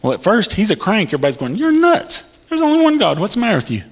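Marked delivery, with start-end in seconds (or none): 1.6-3.8 much higher, scornful jeering; mocking crowd. People scoffing at the doctrine-twister, scornful and high. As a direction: ridicule the crank.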